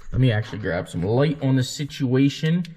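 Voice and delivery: ordinary delivery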